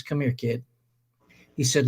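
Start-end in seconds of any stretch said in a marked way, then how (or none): 0.0-0.6 soft, low, kindly summons; gentle mentor. Retold or imitated. Mentor calls over a hurt young player, gently. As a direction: quietly call the kid over, imitating a mentor.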